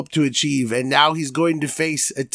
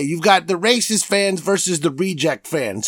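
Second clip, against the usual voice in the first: ordinary delivery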